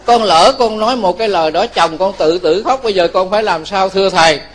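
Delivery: ordinary talk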